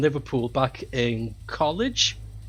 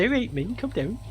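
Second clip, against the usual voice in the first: high, softer mock Scouse; Liverpudlian accent; 8 semitones higher, 4 dB quieter than usual. Stranger in the Tate bathroom to sick student, Liverpudlian accent. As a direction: imitate a Liverpool accent, higher and soft. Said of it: "with a Liverpudlian accent".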